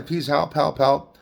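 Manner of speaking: normal talking voice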